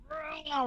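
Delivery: mock roar, high and soft; villain roar. Actress showing the villain's release with a mock roar. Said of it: "getting to be like".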